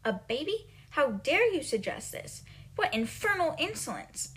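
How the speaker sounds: high, affronted retort; indignant. Retold or imitated. Headmistress insulted by a pupil's suggestion, indignant. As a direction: act out being mildly scandalised and insulted. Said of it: "she takes this as an insult".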